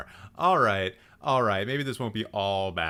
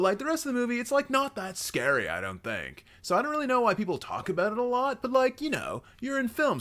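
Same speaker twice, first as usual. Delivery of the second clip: high-pitched, pestering bro voice; high, over-eager, rambling know-nothing pitch; obnoxious film-class acquaintance; 7 semitones higher than usual. Acted. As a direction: play an extremely obnoxious would-be film buff. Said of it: "like, extremely obnoxious".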